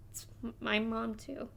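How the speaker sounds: very soft, hesitant admission; quiet. Acted. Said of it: "he says quietly".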